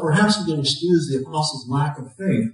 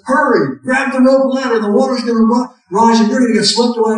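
louder, higher, hurried call over the rotor; urgent pilot; 8 semitones higher, 6 dB louder than usual. Retold or imitated. Helicopter pilot urging man onto ladder, loud urgent call. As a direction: press him to grab the ladder now. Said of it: "The pilot says".

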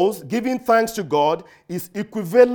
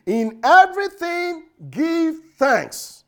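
slow, raised preaching read; scripture reading; 8 semitones higher, 3 dB louder, much slower than usual. Acted. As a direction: proclaim the verse solemnly.

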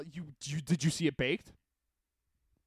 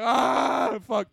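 higher, loud panicked yell; mock panic; 9 semitones higher, 8 dB louder than usual. Retelling panic attack in the theater, hammed-up yell. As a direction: blurt it like you're freaking out. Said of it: "I was like".

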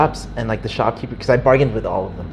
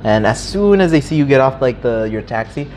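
ordinary talk

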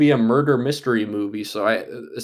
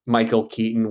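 ordinary talk, nothing marked

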